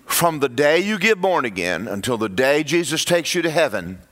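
ordinary delivery

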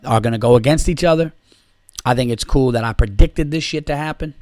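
normal talking voice

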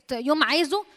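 usual speaking voice

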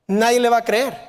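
usual speaking voice

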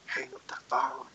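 low, very soft retold command; hushed order. Friend ordering him off the call, hushed. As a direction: say it under your breath, low.

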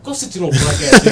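half-laughing high yell; laughing mock yell. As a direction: bark it out, laughing. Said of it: "I'm like".

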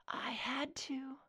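slow, faint, breathy whisper; barely able to breathe out the words; soft raspy wheeze. Acted. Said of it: "her soft, raspy wheeze".

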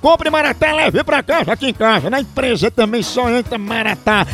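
brisk radio ad pitch for the coffee; fast, pushy on-air commercial voice; ad read. Acted. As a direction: sell it like a live radio spot, quick and upbeat. Radio host reads coffee ad.

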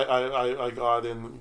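ordinary talk, nothing marked

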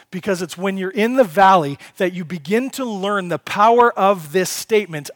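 loud, driving sermon voice, pressing each question on the congregation; full-force preaching. Acted. Preacher pressing the congregation on fearing no evil, loud and driving. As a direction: preach it hard and build, hammering each why.

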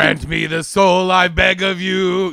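villain incantation. Retold or imitated. Quoting Charles Lee Ray's chant.

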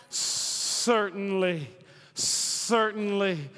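"certainly" drawn out very slowly; emphatic certainty. Acted. Preacher voicing faith-filled people, slow emphatic "certainly". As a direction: stretch "certainly" with a long opening hiss.

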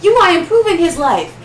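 loud and high, hyped up; excited shout. Real, not acted. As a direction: shout it like cheering her on. Hyped-up advice to women.

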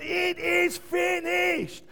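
very high, slow, ringing shout; loud cry. Acted. Preacher acting Jesus' victory cry, ringing shout. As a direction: let it ring across the universe. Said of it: "cried out in a loud voice".

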